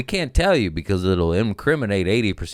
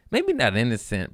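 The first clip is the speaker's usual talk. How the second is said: ordinary talk, nothing marked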